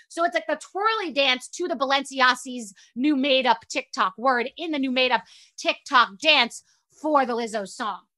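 normal talking voice

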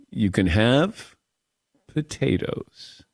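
the doctor deflating the hype; deadpan letdown. Retold or imitated. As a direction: drop the punchline flat and heavy. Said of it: "a big buildup".

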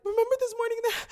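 high, loud, rushed mock wail; imitated tearful outburst. Telling the friend about the morning's card, imitated tearful outburst. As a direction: act out blurting it to a friend, breaking into gasps. Re-enacting crying realisation to friend.

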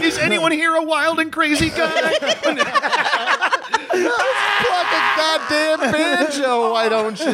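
shrill laughing heckler voice, slow; high mock heckler. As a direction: drag it out like a drunk heckler.

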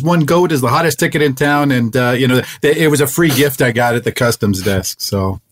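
ordinary delivery

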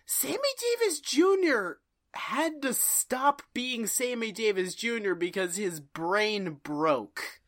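normal talking voice

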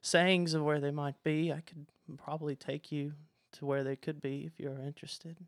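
meek, halting servant voice; nervous, scared underling. Acted. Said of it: "he's so nervous and".

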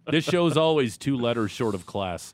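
normal talking voice

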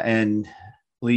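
usual speaking voice

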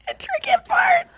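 high laughing squeal. Real, not acted. Host repeating a friend's misused word, helpless high laughter.

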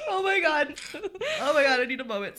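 teary, sniffly voice; 鼻をすすりながら泣く声; snotty crying. Real, not acted. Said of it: "My nose is running".

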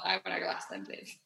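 whispered, timid request. Acted. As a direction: breathe it like a shy customer.

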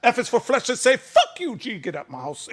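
high, hammed-up angry tirade; pissed drunk father. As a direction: imitate a drunk dad tearing into his son. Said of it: "is pissed and drunk".

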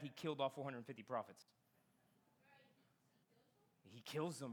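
usual speaking voice

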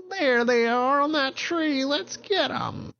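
very high, slow witch screech. Acted. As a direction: gloat like a wicked witch. Witch spots them in the tree.

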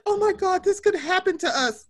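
high mock panic; mocking Sandoval. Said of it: "And he was like".

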